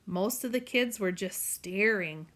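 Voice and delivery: ordinary delivery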